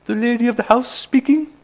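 mock-posh, high and loud singsong phone greeting; hammed-up posh snob on the phone; posh accent. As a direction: answer grandly in a very high, put-on posh voice. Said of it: "in her posh accent".

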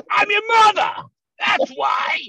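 very high, loud mock-outraged screech; hammed-up high maternal yell; mom shouting back. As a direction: imitate a mother screaming her authority. Mother yells back in story.

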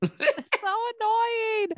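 laughing in frustration; exasperated laugh. Real, not acted. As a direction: laugh it out, fed up. Frustrated laugh at bad internet.

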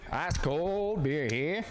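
slow, drawn-out vendor call; stadium vendor hawking. Pretend. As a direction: stretch it out like a ballpark hawker. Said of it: "Beer guy".

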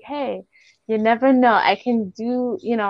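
normal talking voice

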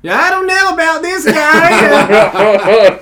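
loud, high, slow Delco radio caller; grumbling Delco sports caller, drawn out; Delco accent. Shown as a demonstration. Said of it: "Let me try, like, a Delco accent".

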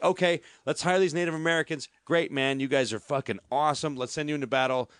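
normal talking voice

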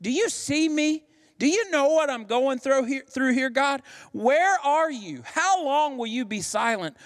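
high, imploring questions to God; crying out to God, pitched high; acted lament. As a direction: plead upward, desperate for an answer. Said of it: "they often reflect this sentiment like".

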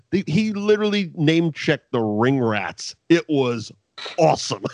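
normal talking voice

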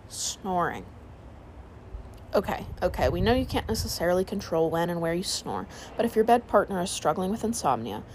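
plain reading voice

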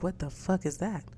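hushed first reaction to a three thousand dollar mortgage; stunned and muted. Retold or imitated. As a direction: say it under your breath, stunned. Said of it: "I was losing it for a moment there".

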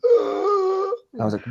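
a sharp high strangled gasp; choked gasp. Retold or imitated. Choked by the son, sharp gasp. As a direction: gasp as if throttled. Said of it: "all of a sudden".

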